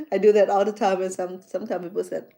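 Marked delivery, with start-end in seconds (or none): none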